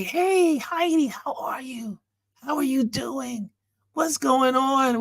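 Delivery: high-pitched singsong greeting, drawn out; high drawn-out hellos in a retold greeting. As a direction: imitate friends calling out a long, high hello. Friends greet woman at trail.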